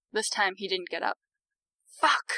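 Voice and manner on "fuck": sharp higher curse; swearing. A narrator's reading. Victoria cursing after knocking the man flat. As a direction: blurt it in frustration. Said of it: "she swore".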